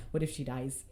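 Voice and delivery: a hint of mock dread; mock worried hush. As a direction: drop into a worried murmur. Retold worry, much quieter.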